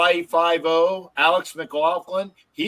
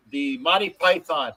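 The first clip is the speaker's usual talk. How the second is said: ordinary talk, nothing marked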